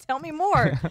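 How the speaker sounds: high-pitched, playful eager interest; mock eagerness. Host playing along as approving locals, mock eager. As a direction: play a local keen to hear it.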